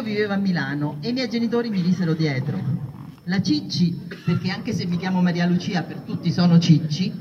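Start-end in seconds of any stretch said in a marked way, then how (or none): none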